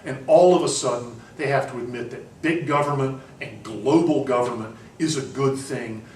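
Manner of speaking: ordinary talk, nothing marked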